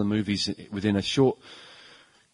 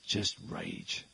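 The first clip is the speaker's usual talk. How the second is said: Harrison Ford line; 4 semitones lower, 11 dB quieter than usual. Retold or imitated. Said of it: "That's his answer".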